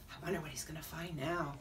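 soft, low, quick aside; quiet aside. Real, not acted. Reader's aside between story lines.

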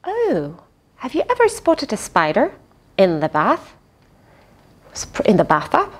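ordinary delivery, nothing marked

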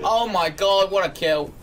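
a sudden high, loud "oh my god"; excited cheer. Real, not acted. Mate watching a great kill, excited loud cheer.

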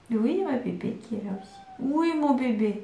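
soft, tender voice for her little one; tender softness. Real, not acted. Mother answers her little one interrupting the video, soft and tender.

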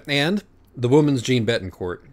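plain reading voice

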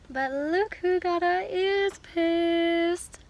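slow, sing-song proud reveal; excited reveal. Real, not acted. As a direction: show off the new earrings slowly. Proud reveal of pierced ears.

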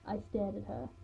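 ordinary delivery, nothing marked

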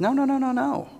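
quick string of high-pitched no's; God stepping in. Acted. God interrupting people who rely on principles, high-pitched protest. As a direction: cut in with high, repeated objections. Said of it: "God kinda steps in and says".

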